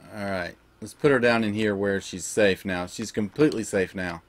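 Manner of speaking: tuck the pet in gently; tender, soothing. Real, not acted. Gamer settling his pet down, soft and tender.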